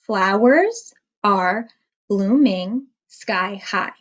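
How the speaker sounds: slow, each syllable of Flowers and blooming separated; slow syllable count for children. Shown as a demonstration. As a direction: count the beats aloud with the class. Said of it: "Let's count the syllables together".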